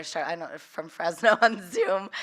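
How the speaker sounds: ordinary delivery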